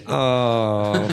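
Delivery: ordinary delivery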